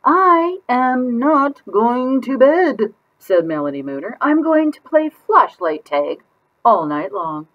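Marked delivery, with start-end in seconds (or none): 0.0-2.9 put-on much higher, very slow stubborn girl voice; stubborn child. Child refuses bedtime. 4.2-7.5 put-on much higher, slowish little-girl voice; gleeful kid plan; excited child.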